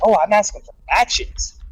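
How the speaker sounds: high, odd put-on voice for the patient; mock innocent patient voice; imitating the patient. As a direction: play the strange guy, airy and unbothered. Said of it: "He's like".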